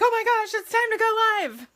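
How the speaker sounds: high, loud mock-panicked cry; frantic outburst. Retelling her last-minute scramble before going live, frantic. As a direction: burst out in a spazzy, hammed-up panic. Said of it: "I'm frantically trying to pick it up".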